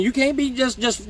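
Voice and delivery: usual speaking voice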